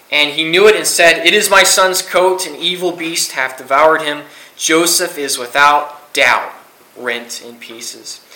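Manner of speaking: measured, solemn Bible reading; solemn scripture reading to a youth group. Acted. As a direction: read the verses aloud with weight, slowing on the quote. Reads scripture aloud.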